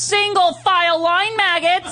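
barked high, slow drill sergeant order. Shown as a demonstration. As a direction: bark it like a squeaky drill sergeant. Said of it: "let me hear you sound like a drill sergeant".

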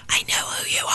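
leaning in close, breathy; hushed whisper. Retold or imitated. Guard leaning forward whispers to the visiting comedian. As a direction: whisper it low and ominous.

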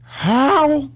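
slow, rising, broken-up lament; pleading Pacino. Retold or imitated. Pacino pleading with Baldwin to show him how to be happy. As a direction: beg him to share the secret. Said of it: "Pacino looked at him and said".

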